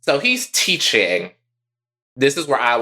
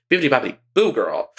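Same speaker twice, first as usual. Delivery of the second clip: slipping briefly into a character voice; lightly put-on sassy sing-out; Drogo; faster than usual. Listing chores, lightly slipping into another persona's voice. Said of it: "I turned into Drogo for a minute".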